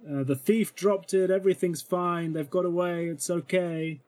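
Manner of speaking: big show. Acted. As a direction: play it up for onlookers. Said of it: "big show of saying".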